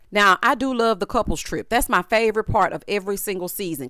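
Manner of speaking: normal talking voice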